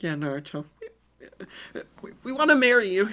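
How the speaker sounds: put-on high, shy then bursting girlish voice; blushing girls. Girls confessing to Naruto, shy high blurt. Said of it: "blushing a little bit harder".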